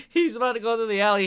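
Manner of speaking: ordinary talk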